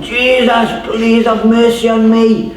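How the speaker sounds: high, drawn-out cry at ordinary volume; mock shouted plea. Blind beggar crying out to passing Jesus, acted high pleading call. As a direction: call out slowly as if from afar. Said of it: "the guy starts shouting".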